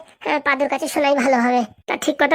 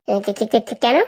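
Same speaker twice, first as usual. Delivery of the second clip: low, stuttered "why"; stammering; 6 semitones lower than usual. Acted. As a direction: get stuck repeating the first syllable.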